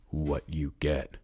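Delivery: slow, deliberate villain voice; unhurried, terrifyingly calm threat; calm menace. A narrator's reading. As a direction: draw each word out with control. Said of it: "Luca calmly wrapped each finger".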